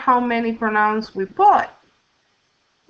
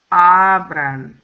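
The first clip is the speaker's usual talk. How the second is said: Abran with the first syllable AB louder and held; stress on AB; 3 semitones lower, 5 dB louder than usual. Shown as a demonstration. Teacher showing students the stressed syllable, AB stressed. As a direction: lean on the opening AB of Abran. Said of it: "the stress is on the next to the last syllable".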